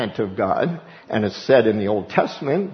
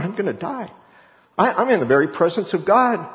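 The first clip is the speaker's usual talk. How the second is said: high, panicked cry of a doomed man; terrified Isaiah; 8 semitones higher than usual. Retold or imitated. Isaiah crying out in dread before God's throne. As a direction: plead in terror before God. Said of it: "He's just going".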